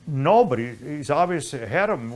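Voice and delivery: ordinary delivery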